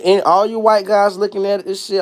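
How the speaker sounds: ordinary delivery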